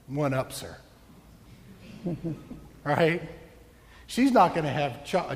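ordinary talk

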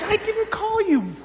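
high-voiced master sending a boy off; exasperated master. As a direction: deny it in a high put-on voice. Master denies calling, high voice.

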